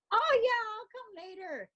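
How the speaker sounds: a light, airy brush-off; Tina brushing off. Retold or imitated. Tina dodging the cake invitation, mock high brush-off. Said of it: "And Tina would go".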